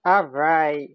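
ordinary talk, nothing marked

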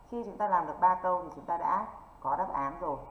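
usual speaking voice

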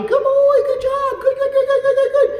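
high, rapid stream of praise while the puppy runs in; excited praise. Shown as a demonstration. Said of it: "I call the puppy".

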